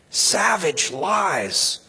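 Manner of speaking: very slow, deliberate; slow condemnation. Real, not acted. Preacher branding the distortions as lies, slow and deliberate. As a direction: drag out each word in disgust.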